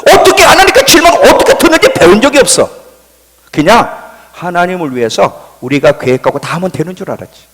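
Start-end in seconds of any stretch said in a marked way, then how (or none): 0.1-2.7 loud, very high retort; acted indignant comeback; exasperated protest. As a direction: blurt the excuse with raised pitch. Retold protest to God's challenge.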